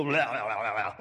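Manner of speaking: low, garbled mock-convulsion noise; imitated demonic babble. Pastor mimics the possessed son convulsing, mock demonic babble. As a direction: babble deep like someone possessed, played for laughs. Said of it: "he begins to convulse and roll around on the ground and go".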